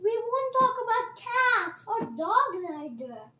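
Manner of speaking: high, loud pleading call; calling after someone. Acted. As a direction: call out loudly after him. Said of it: "she called after him".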